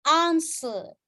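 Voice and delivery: "Answer" with a long British AH and no R; British accent. Shown as a demonstration. Teacher giving the British version, long vowel. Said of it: "Answer itu British".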